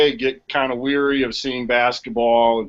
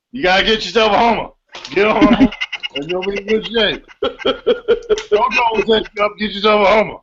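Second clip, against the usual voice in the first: high, gruff Cajun coach voice; Ed Orgeron impression; 7 semitones higher than usual. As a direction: do a hoarse Louisiana coach. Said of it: "what would Ed Orgeron say".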